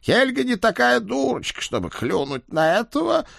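very high, mocking chummy voice; friendly ribbing. Ed teasing his too-serious friend, high and mocking.

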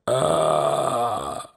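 long rasping death groan; drawn-out hoarse groan. A narrator's reading. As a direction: drag out a hoarse dying moan. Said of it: "тягуче хриплое".

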